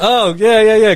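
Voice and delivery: high, loud laughing yeahs; laughing realisation. Retold or imitated. Clerk finally getting Casino Royale, laughing loud and high. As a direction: laugh out the penny dropping.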